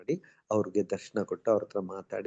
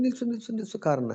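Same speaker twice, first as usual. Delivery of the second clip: sharp high-pitched call to halt the car; calling out to stop; 11 semitones higher than usual. Retold or imitated. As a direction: cry out ನಿಲ್ಸು ನಿಲ್ಸು much higher, urgently flagging a car down.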